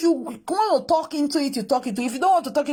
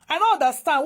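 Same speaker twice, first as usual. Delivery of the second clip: ordinary talk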